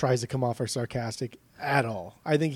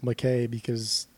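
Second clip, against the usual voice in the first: ordinary talk, nothing marked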